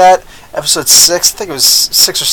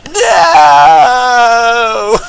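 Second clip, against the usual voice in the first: long, high hammed-up wail; mock-dramatic drawn-out scream of denial; anguished cry; 6 semitones higher, 4 dB louder than usual. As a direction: howl it out like a melodramatic hero. Hammed-up cry answering movie reveal.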